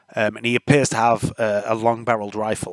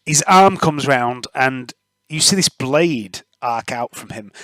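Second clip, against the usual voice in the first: ordinary talk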